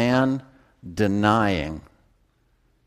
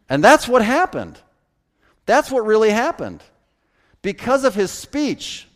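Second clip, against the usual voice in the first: much higher, louder preacher's fervor; fervent preaching; 12 semitones higher, 5 dB louder than usual. Real, not acted. Preacher urging congregation to tell the truth, fervent. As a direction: lift the congregation with rising passion.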